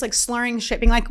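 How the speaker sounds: ordinary talk, nothing marked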